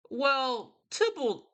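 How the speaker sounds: high-pitched, put-on royal voice; mock prince. Prince answering the Capulet's plea, mock regal. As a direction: pitch it up like a hammed-up ruler.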